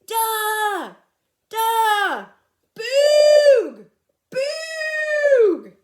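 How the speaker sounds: very loud high calls into the forest; yelling. Acted. Fred yells into the forest for the cavemen, loud high calls. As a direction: hands cupped, yell out long. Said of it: "yelled into the forest".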